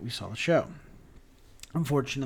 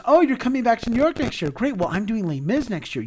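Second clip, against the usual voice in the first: higher, quicker delighted quote; retold eager reply; 8 semitones higher, 5 dB louder than usual. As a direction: imitate someone pleased at the news. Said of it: "And he goes".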